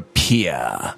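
"peer" said as "pee-ah", R dropped; mimicked RP vowel on "peer"; imitated upper-class pronunciation. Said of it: "it stands for received pronunciation".

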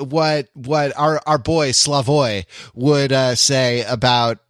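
normal talking voice

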